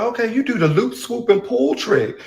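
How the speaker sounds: high, slow, wide-eyed fake wonder; playing dumb. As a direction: act amazed at something simple. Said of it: "I play stupid even when".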